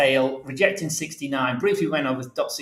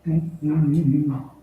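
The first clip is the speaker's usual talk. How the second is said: off mic; slower than usual. Real, not acted. Still searching files, off mic.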